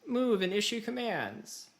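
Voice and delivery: high, slow instructional voice; lightly voiced game narrator reading an order; mock campaign prompt. Host imitates the campaign tutorial's slow instructions, high pitched. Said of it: "It was like".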